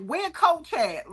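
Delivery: drawn-out fan question; imitating a curious fan. Fans asking about the dog.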